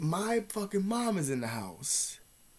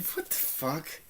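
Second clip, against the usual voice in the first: stunned disbelief; 9 dB quieter, much slower than usual. Retold or imitated. Teen reacting to a blackmail threat, stunned. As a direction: retell a quietly stunned reaction.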